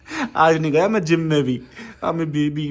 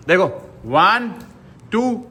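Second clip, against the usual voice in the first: ordinary talk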